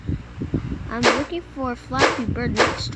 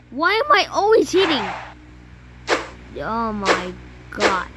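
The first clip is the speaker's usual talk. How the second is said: usual speaking voice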